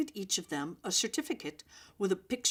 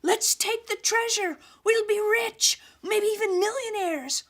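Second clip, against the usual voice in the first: high, loud, greedy shout with treasure stressed; 13 semitones higher, 6 dB louder than usual. A narrator's reading. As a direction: yell it, thrilled by the riches. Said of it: "Stephanie shouted".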